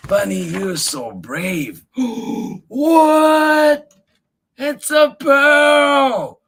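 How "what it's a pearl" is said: loud, high delighted cry, slow on "It's a pearl"; thrilled shout. Acted. Finds a pearl.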